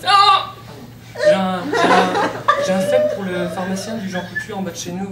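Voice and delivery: giggly fluster. Acted. Flustered confession about crush.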